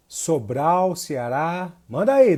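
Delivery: ordinary delivery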